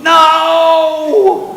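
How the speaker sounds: a high, loud laughing "No!"; inner scream. Retold or imitated. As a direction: shriek it like your inner panic, laughing. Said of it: "but inside I was going".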